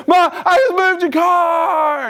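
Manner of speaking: put-on high, drawn-out kid's holler; calling out. Son proudly announcing to Mom, high hollering. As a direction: imitate a boy yelling for his mother, stretched out. Son calls out to his mother.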